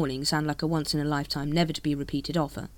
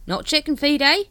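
high, loud cockney-ish hawker; street hawker voice; 10 semitones higher, 6 dB louder than usual. Acted. Dibbler greets the Captain, high hawker voice. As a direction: sidle up like a spiv.

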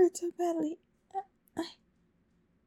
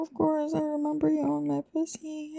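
ordinary talk, nothing marked

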